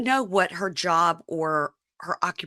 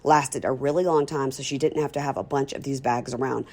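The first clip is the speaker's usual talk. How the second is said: ad read; 2 semitones lower, 3 dB louder than usual. Acted. Sponsor ad read.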